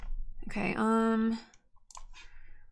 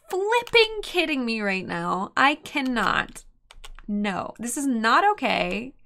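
slow exasperation; 3 dB louder, slower than usual. Real, not acted. Exasperated at Oko again.

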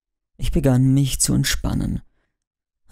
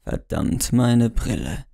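usual speaking voice

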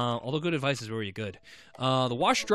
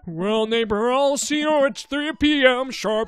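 high, loud put-on neighbor greeting; cheery neighbor character; 9 semitones higher, 8 dB louder, slower than usual. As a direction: pitch the barbecue with hammed-up friendliness. Voicing the neighbor's goodbye.

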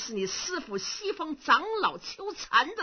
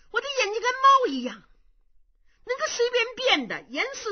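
louder, higher, faster on 猫 falling; insistent repeat; 13 semitones higher, 7 dB louder than usual. Acted. Impostor insisting to the young monks, emphatic. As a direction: hammer home that 猫 eyes change. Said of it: "repeated claim, insistent".

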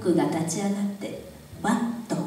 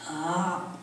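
put-on creaky wise old man; 3 semitones lower, 7 dB quieter than usual. A narrator's reading. Grandfather, low and soft.

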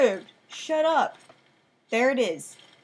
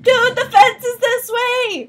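high, loud, slow yells; panicked shouting; 13 semitones higher, 15 dB louder than usual. Real, not acted. Fleeing monster panic.

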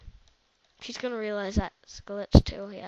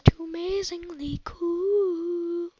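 high, slow mock sob; tearful dramatic wail; mock crying; 6 semitones higher, slower than usual. Dramatic overacted gaming line.

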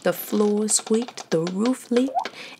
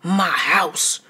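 hollered; 2 semitones lower than usual. A narrator's reading. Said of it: "Fred hollered".

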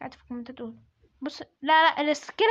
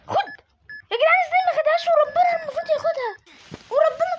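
much higher and louder, crying out in disbelief; outraged protest; 12 semitones higher, 7 dB louder than usual. Real, not acted. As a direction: yell at the unfair game. Gamer protests a missed hit. (احتجاج صارخ بصوت عالٍ وحاد)

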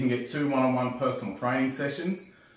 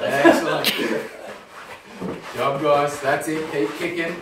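excited cheering; 3 semitones higher, 6 dB louder than usual. Real, not acted. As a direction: cheer them on hard. Cheering on the kick sits.

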